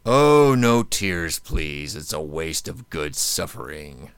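slow, measured sinister line; a hint of Pinhead. Host quoting Hellraiser for cohost, slow and sinister. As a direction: savour each word like a villain. Horror quote for guessing.

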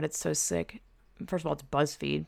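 ordinary talk, nothing marked

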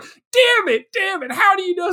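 high, strained mock frustration; frustrated character. Mocking the movie dad who got tricked, in a high frustrated whine. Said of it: "And he's like".

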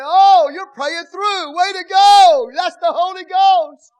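hammed-up cheer for the crier; mock cheering. Onlooker cheering a crier at the altar, mock shouted praise. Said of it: "you think".

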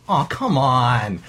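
drawn-out mock plea; pleading. Unicorn begging the bartender to serve it, pleading. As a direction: play a begging unicorn, stretching the words. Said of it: "looks up at the bartender with pleading eyes".

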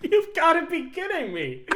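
high voice squeezed through laughter; laughing disbelief. Real, not acted. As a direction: say it while cracking up. Cracking up at private-person line.

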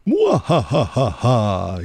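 slow villainous cackle; gloating evil laugh. Acted. Villain gloats at tricked heroes.

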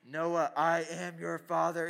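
loud, slow mock-villain intoning; drawn-out ominous prank voice; Darth Vader. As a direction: stretch it out like a booming villain. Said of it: "My Darth Vader voice".

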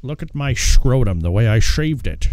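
put-on Sean Connery style Scottish accent; Connery impression.

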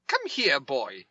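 prim precise enunciation; Harry Potter style English lady; prim English governess. Retold or imitated. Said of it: "She does not sound like that".

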